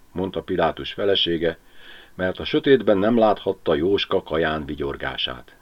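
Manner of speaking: ordinary delivery, nothing marked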